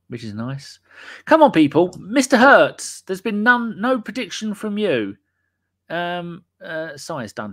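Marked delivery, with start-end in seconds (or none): 1.3-1.9 loud urging. Real, not acted. Urging listeners to send predictions. 2.0-5.2 calling out. Real, not acted. Calling out a listener.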